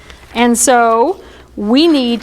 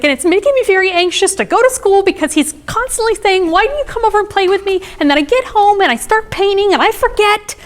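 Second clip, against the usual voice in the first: mock childish distress, pitched way up; anxious six-year-old; 10 semitones higher than usual. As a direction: imitate a little girl getting more and more worked up. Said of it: "she's like".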